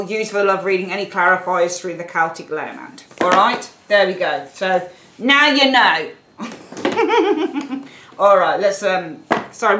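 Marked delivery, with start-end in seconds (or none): 6.4-7.8 high cackling witch laugh; witch cackle. Real, not acted. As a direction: let out a shrill witchy cackle. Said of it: "when I laugh like that, my family says I sound like a witch".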